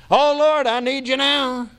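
a hammed-up frantic call for help; desperate plea. Congregation's panicked cry to God.

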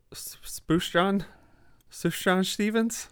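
hesitant, questioning try at "Spoosh John"; unsure pronunciation. Real, not acted. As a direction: grope slowly through "Spoosh John" with a slushy sh. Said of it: "how you say it".

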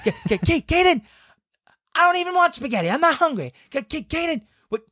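high, stammering, laughing whine; high whiny Kel impression. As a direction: stutter in a high panicky voice. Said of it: "And Kel would be like".

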